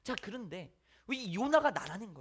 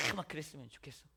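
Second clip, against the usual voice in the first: ordinary delivery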